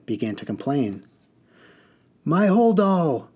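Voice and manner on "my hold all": high, slow, loud wail; yelled. A narrator's reading. Mud woman yelling about her luggage to the driver. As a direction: cry out for the lost bag. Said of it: "yelled a mud woman".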